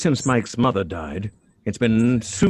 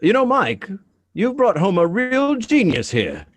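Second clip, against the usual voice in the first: high, bright-eyed enthusiasm; delighted praise; 9 semitones higher, 3 dB louder than usual. Acted. Mother gushing to her son about Susan, high and excited.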